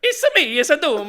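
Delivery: high, loud Italian plumber voice; big cartoon Mario bit. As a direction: shout it like Mario himself. Doom in Mario voice.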